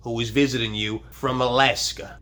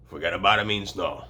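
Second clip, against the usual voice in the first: ordinary delivery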